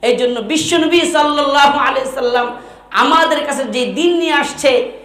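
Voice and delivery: ordinary talk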